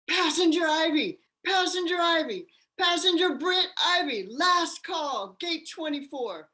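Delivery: high, loud boarding call over the PA; paging a late passenger, last call; airport gate announcement. Retold or imitated. Airport gate call in dream sequence.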